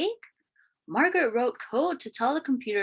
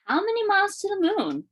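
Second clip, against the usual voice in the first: curious question; 10 semitones higher, faster than usual. A narrator's reading. Child asking wondering question.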